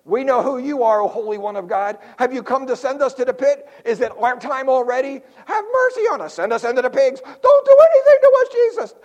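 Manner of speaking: high, loud begging demon voices; pleading demons. Acted.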